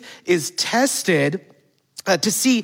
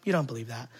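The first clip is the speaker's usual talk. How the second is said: lower, softer hypnotic insistence; Jedi mind trick; 5 semitones lower, 6 dB quieter, faster than usual. Retold or imitated. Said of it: "doing some Jedi mind trick".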